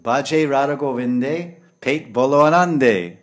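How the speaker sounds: loud, slow, drawn-out recital; ringing, stretched-out declamation; proclaimed Hindi saying. Acted. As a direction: proclaim it slowly and heartily.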